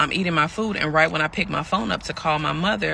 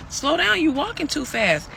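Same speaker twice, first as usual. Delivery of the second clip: high-pitched retold plea; calling after someone; 11 semitones higher than usual. Pregnant woman to partner walking off, high retold plea. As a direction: call out high, struggling to keep up.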